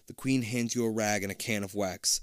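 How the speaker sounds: usual speaking voice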